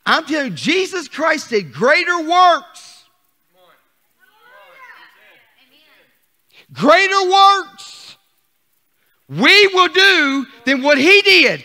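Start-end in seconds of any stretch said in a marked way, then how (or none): 1.7-11.6 slow, high and loud proclamation; preaching shout. Real, not acted. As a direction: stretch each word out at full preaching force. Preacher proclaims Jesus' promise.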